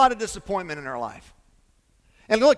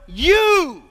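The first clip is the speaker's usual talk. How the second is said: YOU called out high and loud; \"You!\" punched hard and high; emphatic you; 7 semitones higher, 5 dB louder than usual. Acted. Jesus to misfit disciples, emphatic shout. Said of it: "That's the emphasis".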